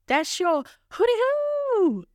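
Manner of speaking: high, slow sing-song hooty-hoo; silly hoot. Acted. Cheering on her creative followers, hooting high and slow.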